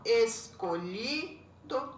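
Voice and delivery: "escolhido" slow in four beats, es - co - lhi - do; split into syllables. Shown as a demonstration. Teacher confirming four syllables, "escolhido" as es - co - lhi - do. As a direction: measure out each syllable of "escolhido". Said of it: "tem quatro sílabas".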